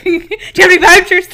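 high, loud laughing cashier line; curt impatient cashier. Retold or imitated. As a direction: rattle it off like a worn-out cashier. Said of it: "I was like".

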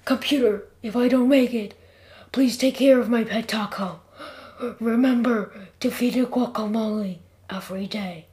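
hammed-up dying plea, broken by pauses; milk the last words like a movie death; melodramatic. A narrator's reading. Jeff gives computer his dying wish for his pet taco, melodramatic. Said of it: "You're way too dramatic".

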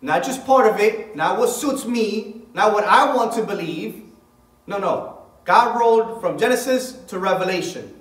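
loud, high, hammering each line as a preacher; fiery preaching. Acted. Preacher driving home a point to his congregation, loud and fervent. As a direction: preach it out full force to the congregation.

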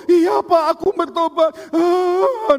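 high, loud tearful promise breaking into a wail; mock sobbing son. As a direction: play a son bawling his repentance. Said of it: "nangis-nangis".

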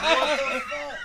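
high, loud, laughing outburst of disbelief; retold shocked cry at the neighbor's story. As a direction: squeal it out, half laughing in shock. Retelling his shocked reaction.

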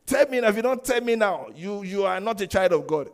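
high, very loud acted outburst; demanding spouse. Spouse accusing a partner who won't answer, loud and demanding. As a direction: play a spouse scolding at full volume. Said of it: "some spouse will say".

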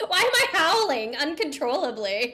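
high-pitched indignant questioning; mock outrage at your own body; retold exasperation. Newcomer demanding why they howled, acted exasperation.

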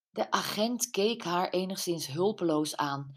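ordinary delivery

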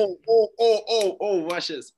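high, loud excited oh oh oh; hyping up a trick. Real, not acted. Player hyping up host before a slang word, excited.

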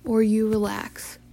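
ordinary talk

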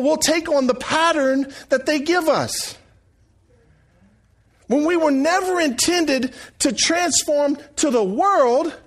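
earnest pulpit exhortation; preaching. Acted. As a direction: drive the message home to the congregation. Preaching to congregation.

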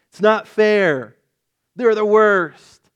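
high, loud, slow sulky wail; petulant Jonah. Retold or imitated. Jonah protesting that Nineveh is spared, high and loud. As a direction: stamp your foot at God.

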